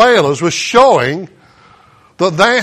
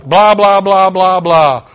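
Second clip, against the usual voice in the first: dismissive blah; 5 dB louder than usual. Retold or imitated. Dismissive list of faults.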